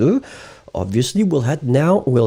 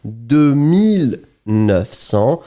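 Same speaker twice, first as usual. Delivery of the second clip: slow teacher explanation; slower than usual. Shown as a demonstration. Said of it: "So we start to combine the two".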